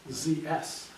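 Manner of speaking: ordinary talk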